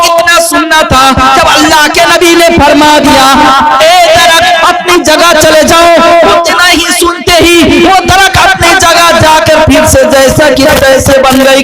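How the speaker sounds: preaching. Acted. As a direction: preach the tale to the gathering.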